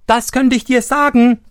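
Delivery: high, loud interruption; loud high cheeky call. A narrator's reading. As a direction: pipe up from the snail shell. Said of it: "rief Daumestick dazwischen".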